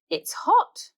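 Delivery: breathy high gasp; gasping hot. Acted. Bluey on the burning footpath, high breathy gasp. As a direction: squeak it out as if feet burn.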